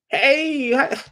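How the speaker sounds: high, slow, drowsy mock voice; groggy bombshell just woken up; imitating a drowsy young wife; sleepy wife. Sleepy wife answers phone.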